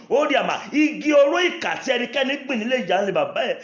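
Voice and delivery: loud, high, fervent prayer over the congregation; full-force preaching prayer. Acted. Preacher praying loudly over the congregation, fervent and high. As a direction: pray over them with full power, pitched high and loud.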